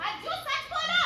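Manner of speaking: high, loud demand for the truth; insistent shout. Acted. Mother loudly ordering son to tell the truth. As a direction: force the son to confess.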